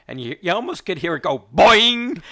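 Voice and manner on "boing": loud, higher mock boing sound effect; a playful cartoon boing; spring-sound boing, hammed-up. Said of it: "my father saying boing".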